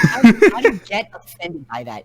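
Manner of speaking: loud, high and cracking up; real laughter, incredulous and loud; laughing disbelief. Real, not acted. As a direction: burst out laughing as you ask. Co-host cracking up at insult.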